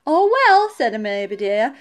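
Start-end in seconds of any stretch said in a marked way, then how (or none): 0.1-0.7 high, loud storybook "oh, well"; shrugging it off. A narrator's reading. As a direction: dismiss the failed attempt brightly and loudly. Shrugs off the failed call.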